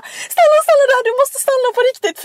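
high, loud pleading yell; panicked scream. Retold or imitated. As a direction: shriek it like a terrified order to stop. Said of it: "vi skrek till han bara". (återgivet panikskrik)

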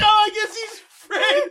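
high, slow, laughing "I guess he's Fred"; laughing mock realization; laughing disbelief. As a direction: say it through laughter, baffled. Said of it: "I was like".

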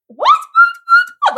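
shrieked very high and loud, rapid-fire; imitated excited screaming. Office girls screaming at her after the call, very high excited shriek. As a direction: squeal the word like the whole office is screaming. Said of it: "Like, they're all screaming".

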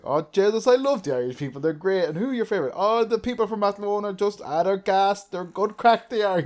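broad, high, loud Irish country voice; Irish accent, mock local fella; thick Irish accent. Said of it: "is this real thick Irish accent".

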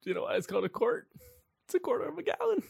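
squeaky mock-exasperated voice; high-pitched explaining. Player arguing about hat measurements, high-pitched exasperation. Comic argument over quarts.

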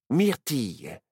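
ordinary delivery, nothing marked

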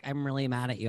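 a hint of a calm, soft angry friend; mock calm anger. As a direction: say you're mad without any heat. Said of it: "She's like".

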